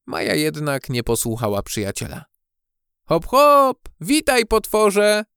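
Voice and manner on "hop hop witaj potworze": high, loud, drawn-out hail; playful calling out. A narrator's reading. Maja greets the supposed monster, playful call. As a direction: holler a merry greeting. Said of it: "zawołała".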